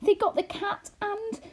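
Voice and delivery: high, anxious rush. A narrator's reading. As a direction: press the frightening memory on him. Homily recalls a disaster.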